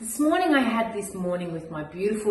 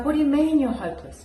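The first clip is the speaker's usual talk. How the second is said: incredulous; 6 semitones higher, faster than usual. Retold or imitated. Mum answers daughter's despair.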